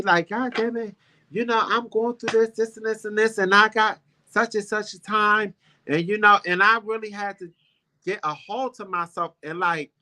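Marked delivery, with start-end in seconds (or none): 0.3-5.5 mildly higher, exasperated venting; imitating a stressed friend griping; upset friend venting. Sick friend venting to her best friend, mildly exasperated. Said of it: "She said to me".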